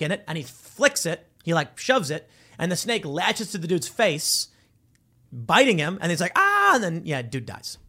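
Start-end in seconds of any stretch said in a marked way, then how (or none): 6.3-6.7 high, loud yelp of pain; imitated scream; mock scream. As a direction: cry out as if snakebitten. Acts out man bitten by snake.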